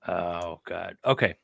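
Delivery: ordinary delivery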